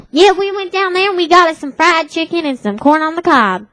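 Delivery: high-pitched Tennessee twang. Retold or imitated. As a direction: put on a friend's Southern country accent. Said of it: "with this Hickish accent".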